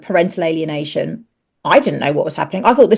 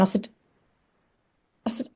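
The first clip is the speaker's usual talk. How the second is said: normal talking voice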